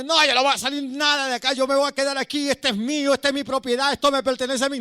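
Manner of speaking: a demon sneering that this man is his. Retold or imitated. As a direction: snarl it like a possessive demon. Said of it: "él seguía insultándome y diciéndome".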